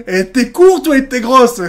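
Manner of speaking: loud, high question through laughter; laughing question. Real, not acted. Streamer joking about the clip he watches, laughing loudly. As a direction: blurt it out loudly while cracking up. Cracking up at his own joke.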